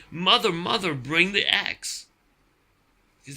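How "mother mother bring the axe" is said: high, urgent storybook shout; loud shout. A narrator's reading. Jack calling his mother for the axe, shouting. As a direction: call out loudly, drawing out the end. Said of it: "Jack shouted as loudly as he could".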